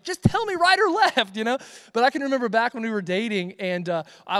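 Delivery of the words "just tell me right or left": exasperated wife. Retold or imitated. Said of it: "and she's like".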